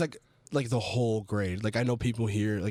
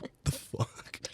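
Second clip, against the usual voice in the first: hushed, voiceless mock disbelief; stunned whisper; 7 dB quieter, slower than usual. Walker turning around at a stranger's greeting, stunned whisper. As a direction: whisper it to yourself, baffled.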